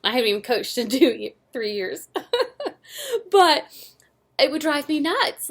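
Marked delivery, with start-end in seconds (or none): 2.1-3.3 sudden high-pitched laugh at herself; high burst of laughter. Real, not acted. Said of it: "I'm getting all worked up". 3.3-5.5 higher and louder, all fired up; real exasperation boiling over; worked up coaching memory told to the ladies. Real, not acted. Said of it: "I'm getting all worked up".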